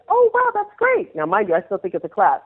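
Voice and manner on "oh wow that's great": high, slow, delighted; naive delight. Retold or imitated. Dancer thanks her cousin, thrilled and unaware, high and slow. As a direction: gush with innocent delight, pitched high.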